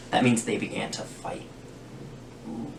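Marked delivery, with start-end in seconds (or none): none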